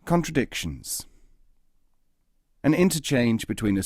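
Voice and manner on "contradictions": ordinary delivery, nothing marked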